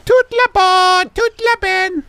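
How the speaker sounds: very high sing-song French chant; squeaky mock-French children. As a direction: chant like little kids running in circles. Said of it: "run around in circles going".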